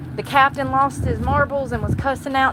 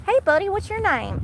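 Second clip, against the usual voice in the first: put-on much higher baby-talk to an animal; talking to a squirrel; 10 semitones higher than usual. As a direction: coo up high at the squirrel.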